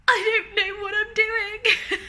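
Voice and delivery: slow, very high-pitched wail breaking into laughter; comic helplessness. Retold or imitated. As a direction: whine it out in laughing bewilderment. Flustered, laughing at her confusion.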